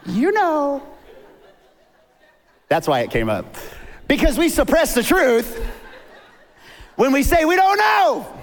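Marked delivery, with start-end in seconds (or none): 0.1-0.8 high, teasing bird voice; parrot. Acted. Parrot taunts the man as he passes, teasing. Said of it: "the parrot goes". 4.1-8.3 high, loud preacher's cry; emphatic preaching. Real, not acted. Preacher drives home the point to congregation, emphatic.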